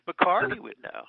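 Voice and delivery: normal talking voice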